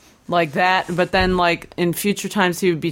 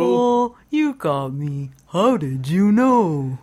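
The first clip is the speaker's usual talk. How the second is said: slow, drawn-out goofy confession; dopey character owning up; invented street voice; 2 semitones higher, slower than usual. Acted. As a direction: stretch each word with exaggerated swooping intonation. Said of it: "Lawrence Avenue just pops up".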